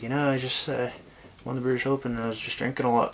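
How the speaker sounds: imitating an Irish accent, quick; casual Irish lilt. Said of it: "in his Irish accent".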